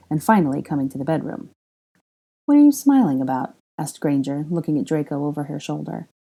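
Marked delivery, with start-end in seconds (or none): 2.4-3.5 higher, louder playful teasing; a higher playful woman's voice; Granger's voice. A narrator's reading. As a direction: tease him, pitched up and bright.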